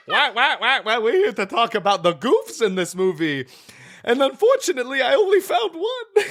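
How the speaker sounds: high, giggly clown voice; silly clown. Acted. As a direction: goof it up like a clown. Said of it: "then they call in the clown".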